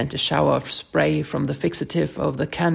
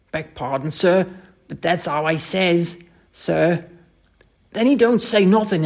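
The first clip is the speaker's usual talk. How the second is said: animated Cockney storyteller, higher and emphatic; Cockney dialect; 4 semitones higher, 4 dB louder than usual. Acted. Thomas tells his employer of the fight, heated Cockney. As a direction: spin an indignant yarn in Cockney. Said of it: "Thomas' Cockney dialect".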